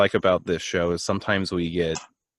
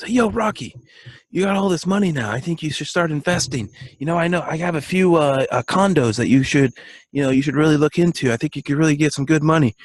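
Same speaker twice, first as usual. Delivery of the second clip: much higher, hammed-up salesman voice; pushy movie guy; 7 semitones higher, 3 dB louder than usual. Retelling a Rocky scene, the condo pitch in a high, hammed-up voice. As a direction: play an eager hustler pitching his condos. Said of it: "He goes".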